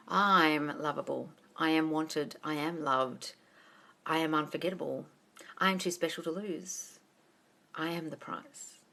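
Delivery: low, soft and slow; quiet confident affirmations; self-assured. Shown as a demonstration. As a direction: say it calmly like you own it. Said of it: "That's self-concept, darling".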